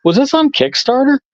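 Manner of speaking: high, surprised question; catching on that he knows this comic. Retold or imitated. At a con table, asking the artist about his book, surprised.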